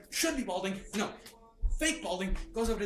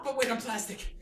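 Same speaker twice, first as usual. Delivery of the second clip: normal talking voice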